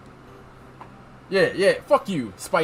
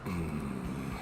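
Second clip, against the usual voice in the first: very low, quiet brooding hum; deep wordless grunt of consideration; Kirk Thornton; 11 semitones lower, 9 dB quieter, much slower than usual. Acted. Brooding passenger weighing an offer, low. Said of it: "do my Kirk Thornton".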